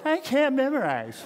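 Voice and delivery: whiny, self-pitying complaint. Shown as a demonstration. Pastor demoing a whine to the congregation, whiny. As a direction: whine it like a sulky kid. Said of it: "say it in a whiny voice".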